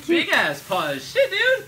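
loud squealing laugh over paws; excited. Real, not acted. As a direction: burst out laughing in delight. Excited over big fursuit paws.

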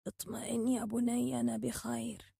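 slow, very soft, hurting; old man whispering through pain. A narrator's reading. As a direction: reassure quietly, in pain. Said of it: "همس بألم".

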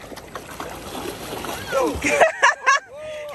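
high laughing giggle; delighted laugh. Real, not acted. Laughing at dog.

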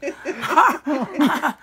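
a big, high burst of laughter; loud laugh. Real, not acted. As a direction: laugh out loud at your own joke. Laughing at her own story.

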